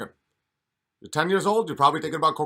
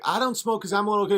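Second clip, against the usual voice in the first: put-on quick, higher kid voice; little kid voice; 7 semitones higher, 3 dB louder, faster than usual. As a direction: rattle it off fast and higher like a small child. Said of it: "kids are like".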